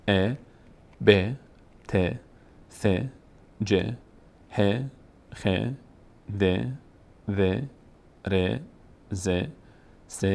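"ب" and each letter read slowly with a short "e" vowel, like be, te, the; careful teacher's recital of each letter with a short e; letters read with kasra. Shown as a demonstration.

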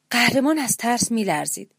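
ordinary delivery, nothing marked

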